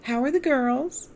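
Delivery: put-on high, sweet elderly voice; widow asks again. A narrator's reading. Forgetful widow repeats her question to Angela, high and sweet. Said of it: "she asked me again".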